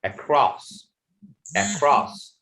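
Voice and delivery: "across" with weak first vowel, stressed "cross"; initial schwa. Shown as a demonstration. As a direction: model "across" as a separate example, twice. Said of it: "we will pronounce it".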